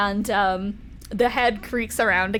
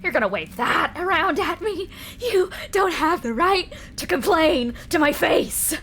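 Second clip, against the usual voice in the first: angry yelling; 9 semitones higher, 5 dB louder than usual. Acted. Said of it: "where the yelling was".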